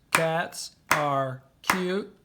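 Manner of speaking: said slowly, counting out each syllable; slow, even beats while writing. Shown as a demonstration. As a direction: tap out each beat of "Cats are cute and fun". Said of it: "So that's five syllables".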